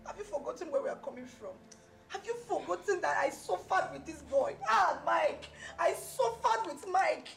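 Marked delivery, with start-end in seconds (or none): 2.1-7.3 loud, high, wounded protest; anguished outburst. Acted. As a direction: cry it out in hurt indignation. Argues she suffered with Mike.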